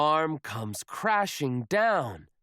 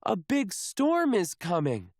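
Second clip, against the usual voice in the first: urgent warning; 6 semitones higher than usual. Acted. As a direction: warn of the storm urgently. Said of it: "shouts First Mate Callie".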